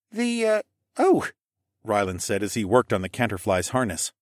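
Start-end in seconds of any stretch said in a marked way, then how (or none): 0.1-1.3 put-on high, faltering boy voice; stumble, then realize; hesitant boy. Boy falters answering Alex, high and unsure.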